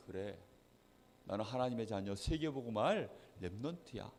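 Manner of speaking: soft, low murmur to oneself; quiet self-affirmation. Acted. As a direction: take it to heart, softly and low. Child takes words to heart. (낮고 조용하게 혼잣말로 다짐하듯)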